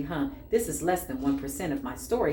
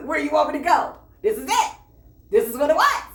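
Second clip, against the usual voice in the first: much higher, louder, giddy laughter; gleeful laughing; 9 semitones higher, 6 dB louder than usual. Real, not acted. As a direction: crow with delight that you got out. Gleeful retelling of her escape.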